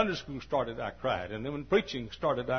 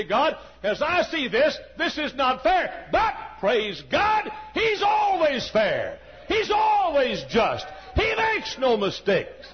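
high, loud, slow preacher's cry; praise shout; 10 semitones higher, 6 dB louder, slower than usual. Shown as a demonstration. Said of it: "Add this to it".

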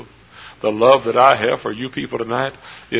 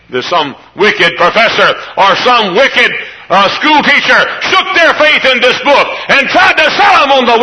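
high, loud hellfire preaching cry; full-force preaching; 14 semitones higher, 10 dB louder than usual. Acted.

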